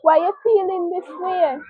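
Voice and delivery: put-on a little girl soothing her mother; imitating her comforting daughter. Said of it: "and say".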